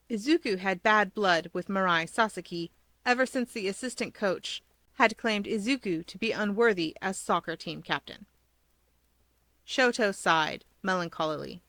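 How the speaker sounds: ordinary delivery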